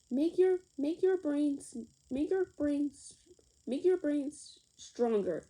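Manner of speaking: ordinary delivery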